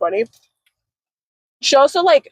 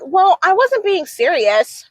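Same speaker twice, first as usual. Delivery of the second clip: high, drawn-out mimicked protest; mock her slow, defensive excuse; 6 semitones higher, slower than usual. Friend excusing her flirting, mimicked defensively.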